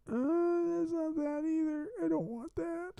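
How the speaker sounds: small, high-pitched complaint; high soft whine. Real, not acted. Streamer rejecting another wrong option, high soft whine. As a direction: whine softly at the screen.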